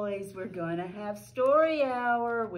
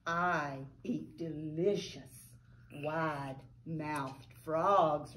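slow, drawn-out alligator threat; lightly voiced sly alligator, slow; menacing alligator; 6 semitones lower, 4 dB quieter, slower than usual. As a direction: savor each word like a hungry gator.

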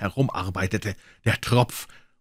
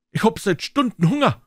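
much higher, louder whine; whining boy; 13 semitones higher, 4 dB louder than usual. Acted. Hungry boys whining, high and loud. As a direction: complain like a starving child.

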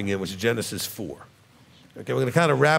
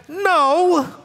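emphatic no; 13 semitones higher, 3 dB louder than usual. Real, not acted. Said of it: "flat rejection of the video".

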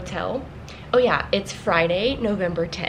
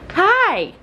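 high, loud cheery hi; bright greeting; 11 semitones higher, 6 dB louder than usual. Real, not acted. Vlogger greeting Erin in her room, excited. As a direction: sing out a greeting to a friend.

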